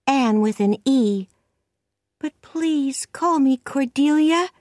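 put-on high-pitched little girl voice; high, slightly loud child voice; pleading orphan girl. A narrator's reading. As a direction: plead earnestly as a small child.

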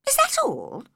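a hint of indignant little girl; young Alice voice. A narrator's reading. Alice holds back anger.

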